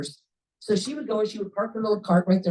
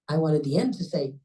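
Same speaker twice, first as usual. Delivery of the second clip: plain reading voice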